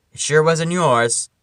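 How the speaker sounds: ordinary delivery, nothing marked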